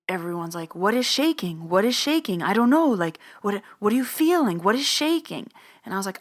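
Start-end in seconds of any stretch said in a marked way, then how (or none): 0.8-5.5 high, insistent imitation of baffled people; puzzled retold questioning. Everyone in the room asking what shaking is, puzzled and high. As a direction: pile up the questions in a raised pitch.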